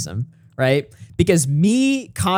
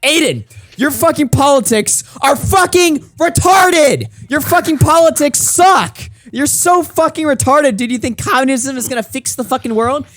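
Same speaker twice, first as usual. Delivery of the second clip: retold screaming rant; 12 semitones higher, 5 dB louder than usual. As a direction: scream it at him like the argument is still going. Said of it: "I'm screaming, like".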